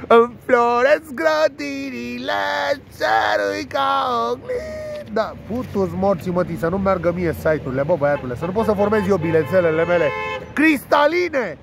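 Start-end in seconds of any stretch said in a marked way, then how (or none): 0.1-5.1 slow, high, hammed-up poet voice; mock poetic recital. 10.5-11.6 drawn-out, high, hammed-up word; mock grandeur.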